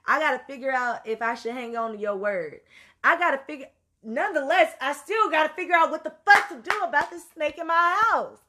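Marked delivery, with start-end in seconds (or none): 3.0-8.4 higher and louder, exasperated; frustrated outburst. Real, not acted. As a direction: let the frustration spill over. Frustration bursts out.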